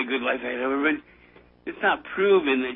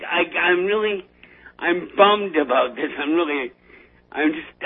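ordinary talk

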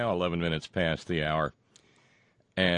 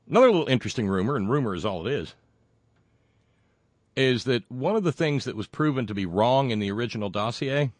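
ordinary delivery

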